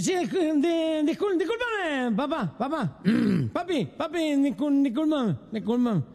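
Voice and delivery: put-on high, slurred drunk stammer. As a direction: stumble over the apology like a drunk. Drunk stammering apology in joke.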